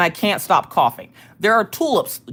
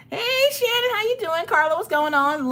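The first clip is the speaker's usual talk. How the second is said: sing-song greeting; 13 semitones higher than usual. Acted. Streamer greeting viewer high.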